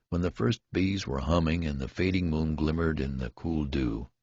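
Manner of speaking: plain reading voice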